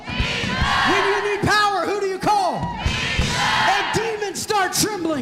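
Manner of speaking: high, loud, shouted sermon; fiery preaching. Acted. Pastor rousing the congregation, shouted preaching. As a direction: preach it full force to the church.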